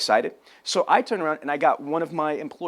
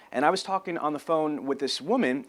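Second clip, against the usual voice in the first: normal talking voice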